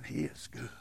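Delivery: soft whisper; whispered awe. Acted. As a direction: breathe it out like a secret. Quiet awe in sermon.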